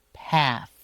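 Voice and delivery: Path ending with tongue between teeth. Shown as a demonstration. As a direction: stretch the TH of Path. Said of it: "stick out your tongue a little bit here".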